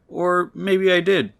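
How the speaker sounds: second-guess yourself in a squeaky voice; a sudden high, drawn-out maybe; high doubtful afterthought. Acted. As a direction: pitch jumps way up, slow and unsure. Flustered guy rethinking his keys.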